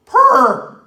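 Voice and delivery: high, loud shout of PER; shouted emphasis. Shown as a demonstration. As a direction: belt the word out. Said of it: "Can't scream it enough".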